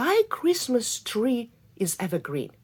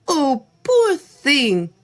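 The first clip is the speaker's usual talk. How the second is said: kind fir tree; 8 semitones higher, 7 dB louder, much slower than usual. Acted. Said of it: "the fir tree exclaimed".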